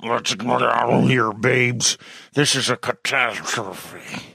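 growling werewolf speech; disgusted growl. A narrator's reading. Werewolf Rob urges his wife to leave, growling in disgust. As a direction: force the words through a beast's throat. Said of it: "Rob managed a disgusted growl".

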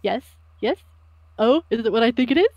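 hopeful, rising little questions; eager enthusiasm. Acted. Sammy hoping to hear Satoru's secret, enthusiastically eager. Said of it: "like, enthusiastically. He's like".

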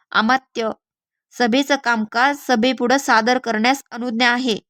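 ordinary delivery, nothing marked

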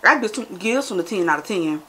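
eating and describing taste; talking while chewing. Real, not acted. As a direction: mouth full of candy. Seen 1: Right speaker's jaw and lips keep chewing between words; candy bowl near.

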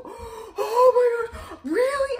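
high, drawn-out gasping amazement; excited little kid. Retold or imitated. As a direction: squeal it like a kid on Christmas morning. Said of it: "like he was a little kid".

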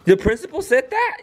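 very high, incredulous question; shocked disbelief. Real, not acted. Parent hears child may be suspended, shocked disbelief. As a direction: shoot up high in real disbelief.

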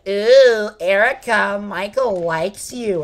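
put-on schoolyard taunt; sing-song mock teasing. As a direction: tease in a silly imitation voice, drawing out the end. Imitating her teasing about a crush.

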